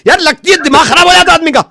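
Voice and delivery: high-pitched, aggrieved mock rant; exasperated outburst. Technician tells customer his head is spinning, exasperated outburst. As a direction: vent as if driven mad. Prank technician still ranting.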